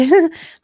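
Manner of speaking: high flustered giggle; giggle, caught off guard; nervous giggle. Acted. Kate giggling at Liam's flirting, high nervous giggle.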